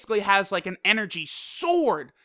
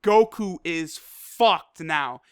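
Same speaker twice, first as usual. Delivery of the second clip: ordinary talk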